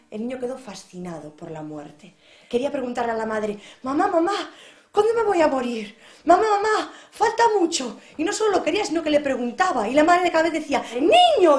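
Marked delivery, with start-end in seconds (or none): 3.8-8.0 put-on high, loud repeated childish asking; loud eager child questions; pestering little boy. A narrator's reading. Child voiced in told story. 10.9-11.6 loud high rebuke; scolding mother. A narrator's reading.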